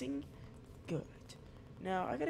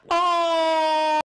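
much higher, louder panicked cries; real panic; 11 semitones higher, 10 dB louder, much slower than usual. Real, not acted. Gamer watching himself die, panicked high cries. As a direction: cry out as death comes.

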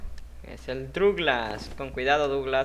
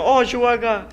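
dismayed shout; 9 semitones higher, 9 dB louder than usual. Real, not acted. Player falls into lava.

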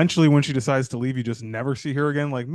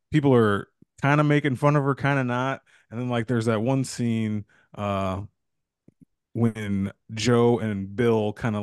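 ordinary talk, nothing marked

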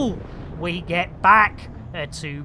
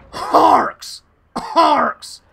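theatrical call; 7 semitones higher, 8 dB louder than usual. Acted. Calling out theatrically.

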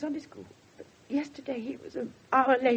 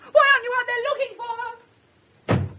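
crying, louder and higher, voice cracking; tearful outburst; 10 semitones higher, 10 dB louder than usual. Acted. Mother of missing girl confronts the inspector, crying out. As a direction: demand it through tears. Distraught mother challenges the police.

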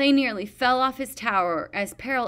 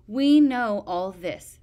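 slow, bored and louder, mid-yawn; 9 dB louder, slower than usual. A narrator's reading. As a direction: drag it out like she can't be bothered.

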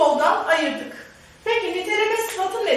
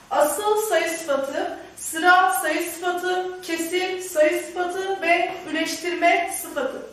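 slow, deliberate dictation pace; slow listing; much slower than usual. Shown as a demonstration. Teacher dictating to students, slow and deliberate. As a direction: spell out each type slowly for the class. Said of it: "teacher listing types slowly".

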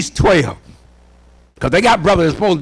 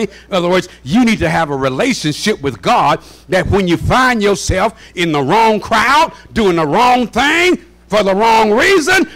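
normal talking voice

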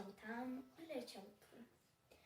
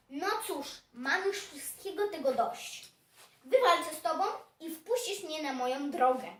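louder, fed-up outburst, quickening; acted anger; 8 dB louder than usual. Fed-up dog to hedgehog, mildly angry and loud. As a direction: play a dog who has lost patience. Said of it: "Pies się wkurzył".

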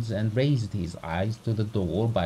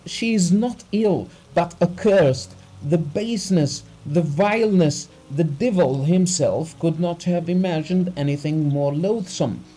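high, loud, overwrought tirade; distraught crying out; 7 semitones higher, 6 dB louder than usual. A narrator's reading. Betrayed husband raving to the doctor about his wife, distraught cry. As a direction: rail at the betrayal, voice pushed high. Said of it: "cried Abugin, laughing, crying".

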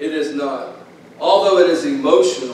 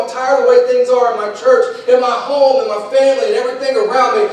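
crying out to God; 10 semitones higher, 4 dB louder than usual. Retold or imitated. Preacher retells praying in his garage, pleading. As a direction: beg God as if praying aloud. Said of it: "I'm crying out to God".

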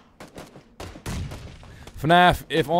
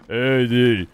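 loud movie-hero calling out; action movie voice; 8 dB louder, much slower than usual. Pretend. Streamer doing a movie impression calling Adrian, loud bellow. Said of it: "This is the action voice movie".

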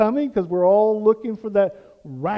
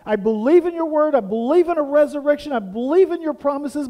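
higher, louder joyful declaration; heartfelt confession; 5 semitones higher, 5 dB louder than usual. Retold or imitated. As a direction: proclaim your new faith. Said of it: "When I gave my heart to Jesus and said".

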